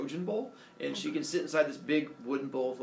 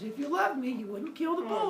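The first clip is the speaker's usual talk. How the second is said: high, whiny guilt trip; pleading queen; 11 semitones higher than usual. Acted. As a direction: whine to make him feel bad. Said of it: "She goes".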